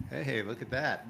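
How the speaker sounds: ordinary delivery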